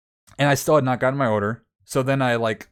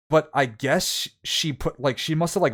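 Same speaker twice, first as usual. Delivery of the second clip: usual speaking voice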